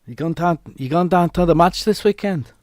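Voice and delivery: high-pitched put-on Scouse; Scouse accent. Streamer asking a mate about the match, high Scouse accent. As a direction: attempt Scouse, pitched well up. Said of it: "That was Scouse".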